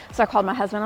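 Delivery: normal talking voice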